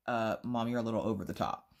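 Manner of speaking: soft, low imitated son; understated reproach. Son telling mom she overdoes it, understated. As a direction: quietly break it to her, imitating a kid.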